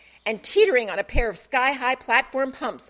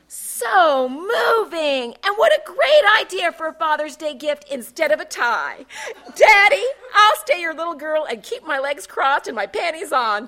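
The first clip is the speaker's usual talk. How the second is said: exclaimed; 6 semitones higher, 8 dB louder than usual. Acted. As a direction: gush with over-the-top delight. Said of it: "Taffy exclaimed".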